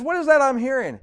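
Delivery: high, loud demanding question; irritated boss. Acted. Boss hears a chopper, high irritated demand. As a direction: demand an answer in exasperated disbelief. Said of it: "The boss is really getting irritated".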